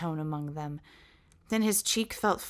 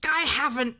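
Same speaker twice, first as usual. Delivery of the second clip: shouted; 7 semitones higher, 4 dB louder than usual. A narrator's reading. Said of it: "the giant shouted".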